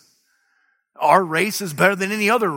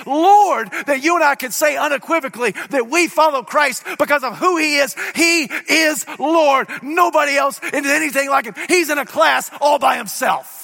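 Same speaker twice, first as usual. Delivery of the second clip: high, loud and driving; full-force preaching; 8 semitones higher, 3 dB louder than usual. Acted. Preacher proclaiming the resurrection to his congregation, full-force preaching. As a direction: preach it hard to the crowd.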